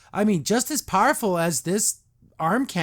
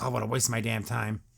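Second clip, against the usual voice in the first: mock annoyed muttering; 6 semitones lower, 6 dB quieter than usual. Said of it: "It's like".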